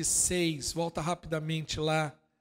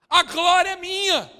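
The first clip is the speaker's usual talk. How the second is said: proud self-made man; 11 semitones higher, 10 dB louder, slower than usual. Retold or imitated. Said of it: "Ela olha e fala assim".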